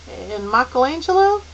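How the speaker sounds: put-on hesitant boy's suggestion; tentative. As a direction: offer it uncertainly, lifting the end. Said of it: "Calvin suggested tentatively".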